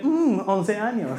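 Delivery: slow breathy drawn-out hum; lightly voiced pop star. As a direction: drawl it like the singer. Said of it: "como diría él".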